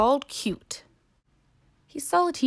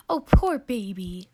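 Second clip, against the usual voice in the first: tender; 4 dB louder than usual. Acted. Parent comforts crying baby.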